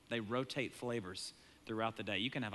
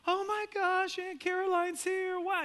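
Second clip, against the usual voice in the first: put-on high, squealing child voice, louder; excited kids; 5 dB louder than usual. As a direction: squeal it like a thrilled kid. Kids excited about aunt arriving.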